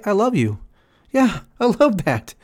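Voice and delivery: a hammed-up sugary thank-you; mock grateful user. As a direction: coo the thanks like a lazy charmer. Voicing the user thanking helper.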